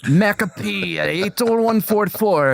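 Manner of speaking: badly faked Russian accent; Scottish gone Russian. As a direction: aim for Scottish, land in Russian. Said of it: "nope, that was Russian".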